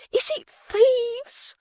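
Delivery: very high, slow, put-on fright; a prim maid squeaking out of the window; Miggs's voice. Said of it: "faked terror at the window".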